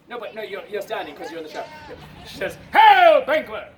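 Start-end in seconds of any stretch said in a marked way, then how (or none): 2.7-3.7 huge first word, falling away on the name; shouted hail. Acted. Messenger hails Banquo, shouted first word. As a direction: blast HAIL, then drop on Banquo.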